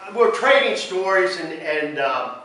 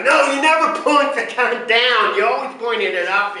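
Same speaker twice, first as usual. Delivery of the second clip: raised, higher voice correcting a buddy; scolding instruction; 5 semitones higher, 4 dB louder than usual. Retold or imitated. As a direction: snap a safety rule at a friend. Steve correcting Ed at range.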